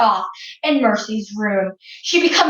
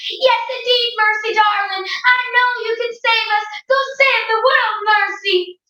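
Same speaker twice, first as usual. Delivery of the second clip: high, fast, gushing praise; doting mother; 8 semitones higher than usual. Acted. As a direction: coo and cheer her on. Missus Watson urges Mercy.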